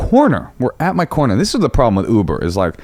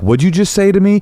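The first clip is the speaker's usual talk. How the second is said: confrontational challenge; 9 semitones higher than usual. Retold or imitated. Confronting driver while recording.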